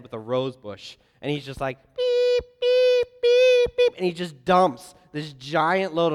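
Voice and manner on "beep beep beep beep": high, piercing beep-beep of a reversing dump truck; squeaky mock truck beeping; imitated truck reverse beep. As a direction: mimic a backing-up alarm. Truck backing up noise.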